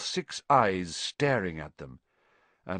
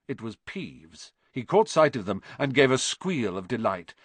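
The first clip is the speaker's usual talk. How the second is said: ordinary delivery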